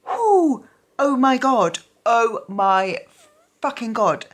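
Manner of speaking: high, loud whoop of disbelief; overwhelmed exclamation. Real, not acted. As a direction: let it burst out, overwhelmed. Overwhelmed by the book's ending.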